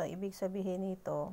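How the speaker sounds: ordinary delivery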